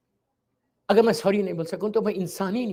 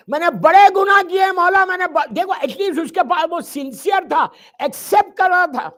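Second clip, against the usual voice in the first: loud anguished cry; 9 semitones higher, 10 dB louder than usual. Acted. Sinner's plea, then preacher's cry.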